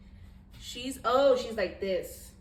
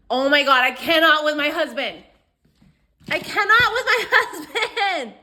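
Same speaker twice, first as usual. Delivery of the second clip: laughing exasperation; 3 semitones higher, 10 dB louder than usual. Real, not acted. Wife who cannot with her husband, laughing exasperation. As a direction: cry out in amused disbelief.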